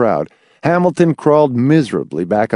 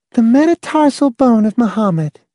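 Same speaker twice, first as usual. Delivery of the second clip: put-on high girl voice; 12 semitones higher than usual. Girl's voice.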